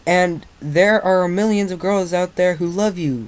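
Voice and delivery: high, loud exasperated outburst; snapping. A narrator's reading. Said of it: "Rainbow Dash snapped".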